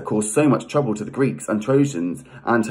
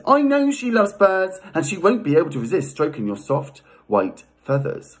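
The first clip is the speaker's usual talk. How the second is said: Aphrodite's persuasion; 8 semitones higher than usual. Acted. Voicing Aphrodite persuading Zeus.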